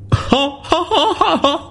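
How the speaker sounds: high crazed monster cackle; maniacal laugh. Acted. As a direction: laugh like a mad beast. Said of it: "Hoàng Yêu cười đến điên cuồng".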